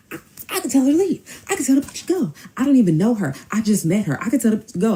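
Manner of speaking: high, loud mock protest; frantic excuse-making. Caught man disowning the woman he just met, frantic. Cornered man pleads his case.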